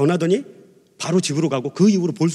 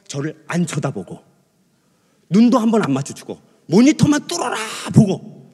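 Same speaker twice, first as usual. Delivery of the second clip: ordinary talk, nothing marked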